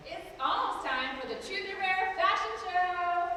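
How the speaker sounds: loud, high, slow host call; noisy announcement. Acted. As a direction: belt it out slowly. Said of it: "let's be noisy again".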